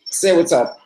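high, loud call to the viewers. Real, not acted. Streamer putting viewers in the next vlog, calling out. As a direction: holler it out to the crowd.

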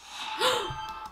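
sharp, high gasp; sudden stunned gasp; shocked gasp. Real, not acted. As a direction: gasp in shock at what you see. Shocked reaction to the video.

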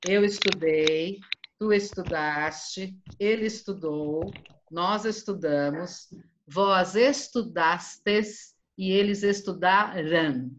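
slow, pronoun of each form stressed; slow dictation. Shown as a demonstration. Teacher repeats the verb table slowly for a pupil who missed it. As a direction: spell the forms out slowly for a pupil. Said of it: "Eu repito pra ela".

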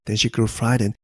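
ordinary delivery

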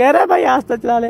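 mock a scared pillion rider begging; mimicking a whiny passenger. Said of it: "ये पीछे वाला रोने लगे". (पीछे बैठे डरे साथी की नकल, रोते हुए)

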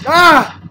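loud high shriek; pained scream. Real, not acted. Player dying in game screams. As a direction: scream as you die.

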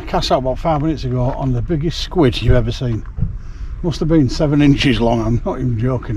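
ordinary delivery

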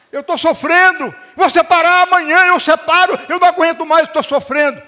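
high, loud, desperate complaint; anguished spouse. Acted. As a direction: wail like a fed-up spouse. Acting a suffering spouse. (cônjuge desesperado, alto e agudo)

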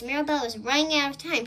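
plain reading voice

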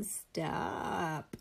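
plain reading voice

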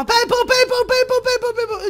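high, loud repeated calling; urgent fan. Retold or imitated. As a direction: call his name over and over eagerly. Boy calling him urgently.

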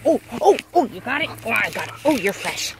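ordinary talk, nothing marked

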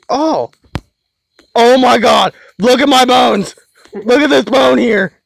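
loud, high-pitched exclaiming; excited yelling. Real, not acted. Player shows his teammate a bone in the game, excited yelling. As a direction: call it out high and loud, thrilled.

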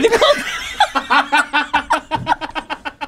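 cackling high laughter; long high laugh. Real, not acted. Laughing at his own headbutt joke, high and long. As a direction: let a long laugh roll out.

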